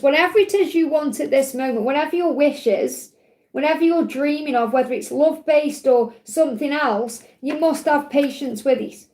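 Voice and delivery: usual speaking voice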